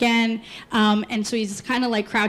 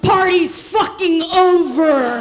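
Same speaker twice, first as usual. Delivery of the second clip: louder, drawn-out imitation of an angry dad; angry father; 4 semitones higher, 4 dB louder, slower than usual. Friend's father breaks up the party, imitated angry bellow. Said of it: "The father's like".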